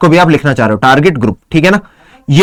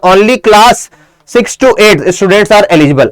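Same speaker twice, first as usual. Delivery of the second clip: slow dictation; much slower than usual. Shown as a demonstration. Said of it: "इन्होंने extra जोड़ दिया".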